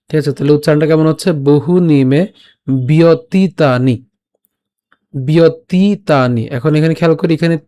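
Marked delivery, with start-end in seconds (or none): 2.9-6.4 "বিয়তিতানি" very slowly, leaning on a long "tee"; stress on long TI. Shown as a demonstration. Teacher models the long vowel, drawn-out stress on "তি" in "বিয়তিতানি". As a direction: stretch and press the "তি" in "বিয়তিতানি". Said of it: "একটু চাপ দিচ্ছি".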